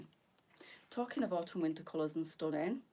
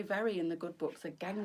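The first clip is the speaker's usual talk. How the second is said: ordinary talk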